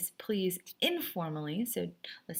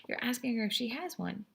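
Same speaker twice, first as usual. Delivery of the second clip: ordinary talk, nothing marked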